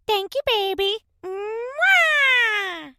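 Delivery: high, loud squeaky thank-you with a kiss; imitating the TikTok bird. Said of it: "like that bird on TikTok".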